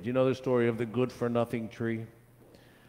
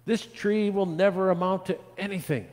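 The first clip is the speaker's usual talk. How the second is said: higher-pitched scornful onlooker; dismissive; 10 semitones higher, 4 dB louder than usual. A narrator's reading. People judge the barren tree, high and dismissive. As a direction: write the tree off in a raised voice.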